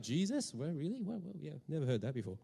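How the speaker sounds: hushed, puzzled, as if never hearing of Jesus; mock clueless believer. Preacher mocking a believer who never heard the name, hushed and baffled. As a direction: play the believer who draws a blank at the name, quietly. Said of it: "it's like".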